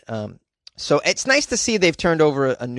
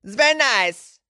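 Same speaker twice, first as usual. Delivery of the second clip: Borat voice; 10 semitones higher than usual. Retold or imitated. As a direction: stretch out very like Borat. Borat catchphrase again.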